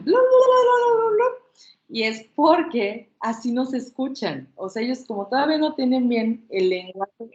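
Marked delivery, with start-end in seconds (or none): 0.0-1.2 put-on high, loud baby babbling; baby babble. Shown as a demonstration. Speech therapist showing parents baby babble. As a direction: babble like a baby trying to talk. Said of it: "ellos empiezan".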